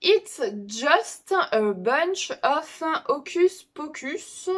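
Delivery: slow English title in a French accent. Real, not acted. Vlogger apologising for her English, slow French-accented English. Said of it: "excusez mon, mon accent anglais".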